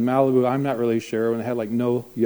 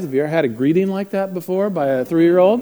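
ordinary talk

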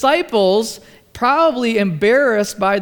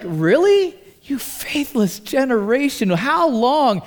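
exasperated rebuke; 4 semitones higher than usual. Retold or imitated. Jesus scolding the faithless disciples, exasperated and raised. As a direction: throw up your hands in mock exasperation. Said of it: "you sense the tone in his voice".

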